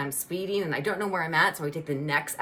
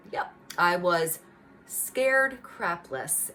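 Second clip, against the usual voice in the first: ordinary talk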